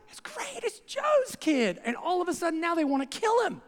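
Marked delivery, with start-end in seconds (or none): 0.1-1.8 high, drawn-out proud neighbour; gushing crowd. Retold or imitated. Preacher mimicking the adoring crowd, pitched high and slow. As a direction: swoon over Joe's kid. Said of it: "they're like".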